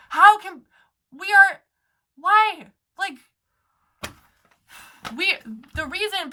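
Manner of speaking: high, loud sputtering disbelief; exasperated outburst. Real, not acted. Defending the movement against the cult claim, exasperated outburst. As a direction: burst out, lost for words.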